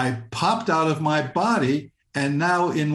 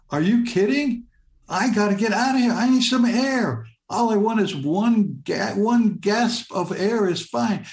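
higher-pitched, acted-out desperate pleading; retold panicked protest; 6 semitones higher than usual. Drowning teenager arguing back at the voice in his head, mock-panicked. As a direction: plead in disbelief, pitch jumping up, without shouting. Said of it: "I say back to the voice in my head".

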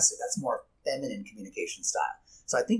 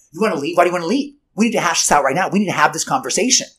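louder, pushing hard, won't let them leave; a partner who insists they hash it out now, raised and pressing; insistent demanding partner; 6 semitones higher, 13 dB louder than usual. Retold or imitated. Partner refusing to let the other walk away, demanding and louder.

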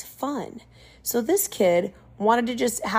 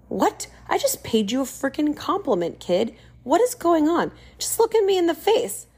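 exasperated disbelief; 7 semitones higher than usual. Retold or imitated. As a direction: let the indignation climb. Kid kicks off shoes.

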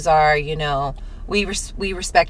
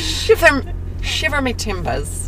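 bad pirate accent; 7 semitones higher, slower than usual. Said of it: "how does one do an Irish accent".

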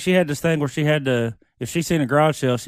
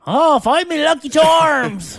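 high, slow mock-Irish leprechaun voice; hammed-up leprechaun brogue; leprechaun accent; 12 semitones higher, much slower than usual. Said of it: "he sounds like a leprechaun".

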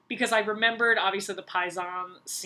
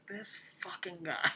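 hushed, whispered aside as the auditor; muttered disbelief; 2 semitones lower, 9 dB quieter than usual. Retold or imitated. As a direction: whisper it under your breath. Voicing the auditor's private reaction.

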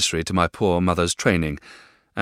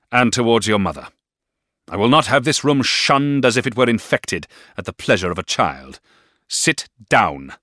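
louder, commanding and cold; stern stepfather command; 5 semitones higher, 7 dB louder than usual. A narrator's reading. Stepfather orders David to obey, commanding. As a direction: lay down the law. Mr Murdstone voiced.

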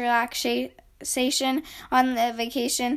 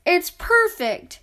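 slow, high, louder delight; excited shout; 6 semitones higher, 4 dB louder, slower than usual. A narrator's reading. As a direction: savour the word like a dream come true. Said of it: "he shouted".

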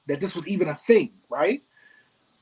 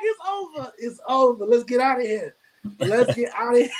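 higher, laughing through the words; half-laughing retreat off the stage; laughing panic; 5 semitones higher than usual. Retold or imitated. Rapper retelling fleeing the stage after the cicada, laughing panic. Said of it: "I was like".

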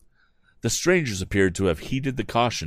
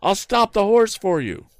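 calling out; 14 semitones higher, 4 dB louder than usual. A narrator's reading. Tom calling to the woman.

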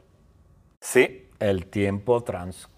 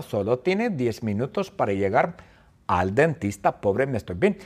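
usual speaking voice

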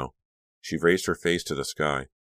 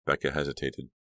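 usual speaking voice

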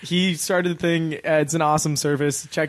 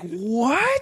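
mocked kid; 5 semitones higher than usual. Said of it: "making fun of this kid, and he's like".

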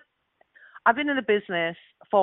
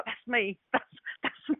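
giddy hushed whisper; 8 dB quieter than usual. Retold or imitated. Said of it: "I want to go around and go".

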